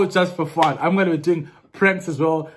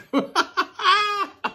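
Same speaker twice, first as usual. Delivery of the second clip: high-pitched real laughing fit. Real, not acted. Man laughing at confused Siri, high real laughter.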